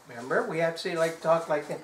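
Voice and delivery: usual speaking voice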